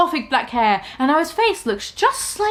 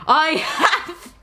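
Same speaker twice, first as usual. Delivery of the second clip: cruel line broken by laughter; 9 semitones higher, slower than usual. Acted. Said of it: "he answered cruelly".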